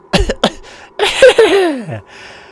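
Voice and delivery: high, loud hacking ghoul cough; crypt cough. Acted. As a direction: cough like a rotting corpse. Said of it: "crypt cough".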